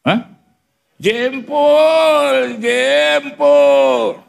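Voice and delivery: put-on high, pained old woman's cry. Old woman in the crowd yelling about her toe, shrill and high. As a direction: yelp like a granny whose toe got stepped on.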